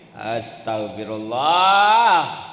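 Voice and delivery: slow, drawn-out scolding astagfirullah; mock astagfirullah. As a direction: drag it out like a self-righteous scold. Mocking misused istighfar.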